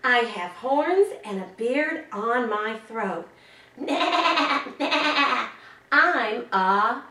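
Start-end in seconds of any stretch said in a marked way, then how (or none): 3.8-5.5 higher bleating goat noise; mock goat bleat. To the child, a goat riddle clue, playful high bleat. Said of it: "animal riddles that you might like to do with me".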